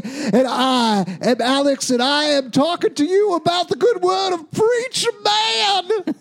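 high, shouted pulpit proclamation; preacher voice. Acted. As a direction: thunder it from the pulpit. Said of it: "Alex has taken over a mega church".

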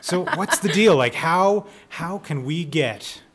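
normal talking voice

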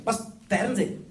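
loud scandalised gasp of a word; shocked disbelief. Retold or imitated. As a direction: recoil as if offended. Hipster shocked at owning TV.

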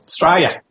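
"Australia" cut down to two syllables, like "Straya"; fewer syllables. Shown as a demonstration. Australian guest corrects host for too many syllables, "Australia" shrunk to "Straya". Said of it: "Too many syllables".

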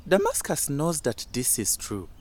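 usual speaking voice

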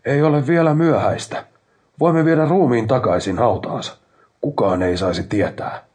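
loud, high plea over the rumble; shouting. A narrator's reading. Narrator begs his brother over the machine noise, shouting. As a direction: shout urgently, easing off at the end. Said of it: "huusin kumun yli".

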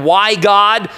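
hammed-up loud protest heavenward; imagined whining scream at God; mock anguished cry. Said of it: "He didn't scream on the spiritual side".

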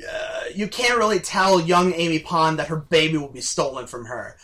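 normal talking voice

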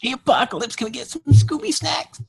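very high, goofy cartoon voice; cartoon snack beggar. Sidekick asks the villain for snacks, squeaky cartoon. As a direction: plead for treats like a cartoon sidekick. Begging Apocalypse in Scooby riff.

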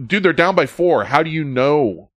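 exasperated. Retold or imitated. Arguing that the team is losing.

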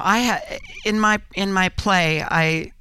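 usual speaking voice

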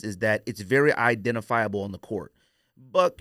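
usual speaking voice

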